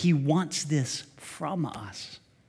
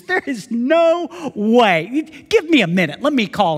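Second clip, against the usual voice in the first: slow, louder, firm disbelief; take-charge sister; 6 semitones higher, 7 dB louder than usual. Retold or imitated. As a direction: refuse it, then take charge. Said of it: "She says".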